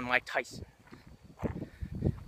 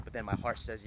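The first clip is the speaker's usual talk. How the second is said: ordinary talk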